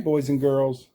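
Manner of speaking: plain reading voice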